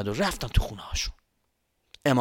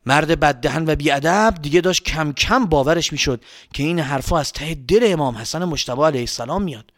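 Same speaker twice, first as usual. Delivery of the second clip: ordinary delivery, nothing marked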